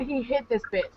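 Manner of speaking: ordinary delivery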